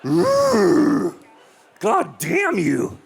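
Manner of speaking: hammed-up enraged outburst with a growl of frustration; high, strained cry sinking into slow cursing; mock anger. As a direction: rage comically at someone too attractive. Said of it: "deal with their beauty is anger".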